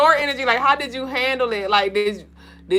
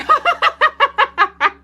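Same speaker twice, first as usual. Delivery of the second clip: loud high burst of laughter; real laugh; 5 dB louder than usual. Real, not acted. Laughing at comment.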